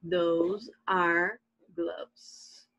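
those are gloves drawn out very slowly; very slow model sentence. Shown as a demonstration. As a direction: spell it out slowly for students. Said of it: "com som de Z também no final". (frase bem devagar, com Z no final)

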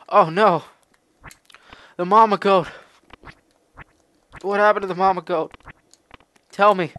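high, loud worried cry; alarmed character. Acted. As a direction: voice the hero in sudden distress. Voicing alarmed character in game.

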